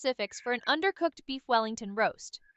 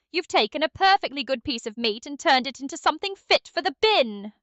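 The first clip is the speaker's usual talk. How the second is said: exasperated British chef telling off a cook; scolding British chef. Acted. Chef Ramsay scolding viewers for a burnt rump roast, angry British accent. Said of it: "why don't you scold us".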